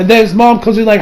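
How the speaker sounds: usual speaking voice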